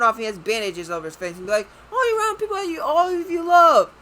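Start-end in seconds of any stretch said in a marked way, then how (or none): 1.9-3.9 mock cheery. Mocking Bruce's cheery line.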